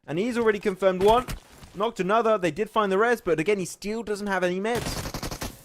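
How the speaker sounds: rising esports call, building to loud high peaks; live play-by-play. Acted. Caster calling a lone player's killing spree live. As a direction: drive the commentary as the fight heats up.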